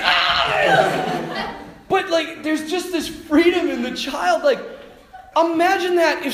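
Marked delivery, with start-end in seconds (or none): 0.0-1.6 put-on kid's squeal. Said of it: "he's like".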